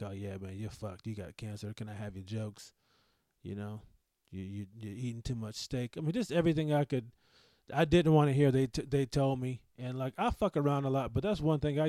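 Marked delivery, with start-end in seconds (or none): 0.0-6.0 low, offhand mock of teasing buddies; mocking friends. Friends teasing him about cancer, low offhand mock. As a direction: toss it off like a careless friend. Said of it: "this is how dudes are".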